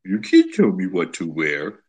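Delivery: slow, stubborn mock-defiant voice; defiant retort. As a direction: play a sulky woman refusing, dragging it out. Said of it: "How does she respond to that?".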